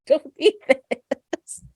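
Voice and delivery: high, slowing alarmed thought; mock dread at a fight; inner panic. Daughter watching two old ladies, high alarmed aside. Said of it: "I thought".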